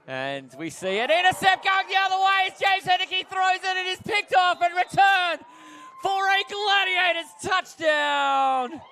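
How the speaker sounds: high, sustained touchdown shout; excited play-by-play. Real, not acted. Commentator calls an interception returned for touchdown, excited shout. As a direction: belt out the turnover as it unfolds.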